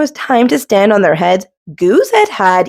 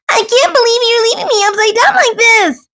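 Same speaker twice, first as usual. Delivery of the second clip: high, fast indignant wail; crying out; faster than usual. Acted. Thistle complaining as Duck leaves, high fast cry. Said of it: "cried Thistle".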